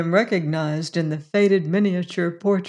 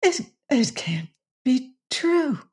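high, halting, near tears; overwhelmed, broken disbelief; tearful disbelief; 7 semitones higher than usual. A narrator's reading. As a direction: stammer it in a small high voice. Bess overwhelmed by the revelation.

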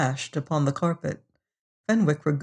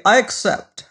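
higher-pitched, brisk acceptance; 5 semitones higher than usual. A narrator's reading. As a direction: agree decisively, no more dithering. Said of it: "he said briskly".

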